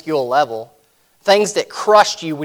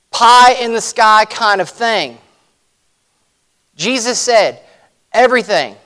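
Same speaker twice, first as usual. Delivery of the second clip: raised, ringing preacher's voice; full-force preaching; 3 semitones higher, 4 dB louder than usual. Acted. Preacher to his congregation, proclaiming Christ owns all authority, full force. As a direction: preach it out to the room with rising power.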